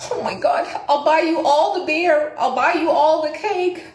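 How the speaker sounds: high, sing-out thankful outburst; gushing gratitude. Retold or imitated. Customer promising beer and cake to lady, gushing gratitude. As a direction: replay being overjoyed, promising the world. Retold grateful outburst at good news.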